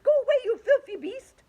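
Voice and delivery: high loud angry yell; furious shout at the shark. A narrator's reading. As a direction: drive the beast off. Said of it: "they shouted".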